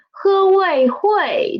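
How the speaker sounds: slow sounding out h, ui, hui; spelled-out sounds. Shown as a demonstration. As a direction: say each part apart then join. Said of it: "The initial 喝, the final 味".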